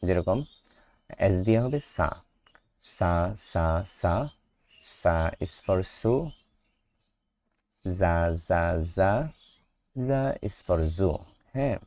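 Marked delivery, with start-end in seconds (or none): 1.9-4.1 সা repeated with a clear hissing s; s sound drill. Shown as a demonstration. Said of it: "এস এর উচ্চারণটা এই জায়গায় কেমন হবে". 7.8-9.1 জা repeated with a buzzing z; z sound drill. Shown as a demonstration. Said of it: "জেড এর উচ্চারণটা কেমন হবে".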